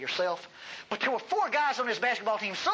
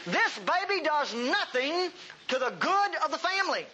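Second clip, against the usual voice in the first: ordinary talk, nothing marked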